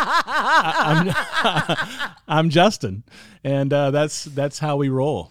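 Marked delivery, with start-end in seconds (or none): 0.0-3.0 awkward laugh breaking through, pitched up high; laughing self-introduction. Retold or imitated. Husband introducing himself to the popcorn lady, laughing. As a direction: stammer your name through embarrassed laughter.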